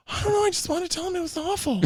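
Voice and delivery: put-on high innocent child; small boy explaining; high squeaky boy voice; high kid voice. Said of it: "he's like".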